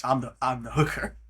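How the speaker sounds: ordinary talk, nothing marked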